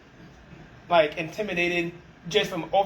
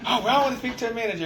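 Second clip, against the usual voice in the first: higher, fast, laughing mockery of an entitled customer; fast mocking customer voice, laughing through it; 7 semitones higher, faster than usual. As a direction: lampoon him demanding a manager, laughing. Mocking angry customer demanding manager.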